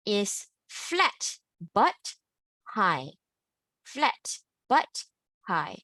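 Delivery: FLAT held high and level, then a pause; flat but high. Shown as a demonstration. Teacher modelling the flat high first tone, slow and high. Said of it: "You notice I go high".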